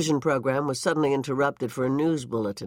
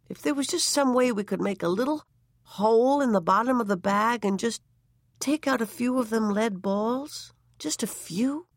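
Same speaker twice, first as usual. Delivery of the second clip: higher, coaxing and wheedling; lightly voiced coaxing wife; 8 semitones higher than usual. A narrator's reading. Wife coaxing husband to lighten his bag, wheedling. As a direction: coax it out gently like a fond wife.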